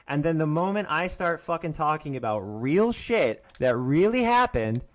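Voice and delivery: ordinary talk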